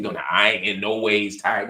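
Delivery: mock Southern church drawl, low; badly faked Southern preacher, pitched low; Hillary Clinton. Mocking a politician's church speech, bad Southern preacher imitation. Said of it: "So Hillary sounds crazy".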